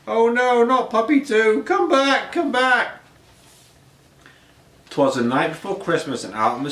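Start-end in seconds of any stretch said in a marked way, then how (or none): 0.1-3.0 high, louder desperate calling; calling after puppy. Acted. Owner calls after the runaway puppy, high and desperate. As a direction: cry out after the pet running away.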